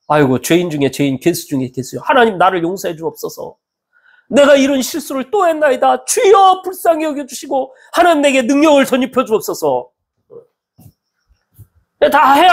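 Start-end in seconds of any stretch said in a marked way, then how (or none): 0.1-9.9 acted penitent prayer. Sinner confessing before God, pleading prayer. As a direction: pray like a contrite sinner, building from subdued to imploring. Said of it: "합리화 되겠습니까?".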